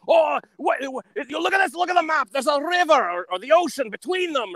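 indignant, high-pitched mad Scotsman; Mike Myers. Retold or imitated. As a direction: rant in a high mock Scottish voice. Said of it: "He gets really mad".

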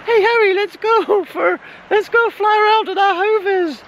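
a wrongly high, squeaky mock Hagrid; hammed-up Hagrid voice, pitched far too high; bellowing Hagrid. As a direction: read Hagrid's line in a comically high voice by mistake. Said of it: "bellowed Hagrid".

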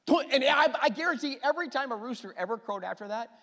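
high, loud, rushing preacher; impassioned preaching. Real, not acted. Preacher guaranteeing what every rooster crow did, high and impassioned. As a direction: burst in high and fast with conviction.

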